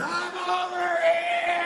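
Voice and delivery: high, drawn-out yell at the sky; desperate yell. Retold or imitated. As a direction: scream it up at the drones, half singing. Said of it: "screaming at drones".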